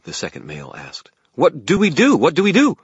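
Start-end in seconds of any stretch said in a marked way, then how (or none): 1.3-2.8 high, loud frantic questioning; panicky. A narrator's reading. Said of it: "echoed in a panicky tone".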